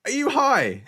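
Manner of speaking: high, loud are you high; mock yourself in disbelief; incredulous. Asking himself if he's high, incredulous. Mocking himself doing the method.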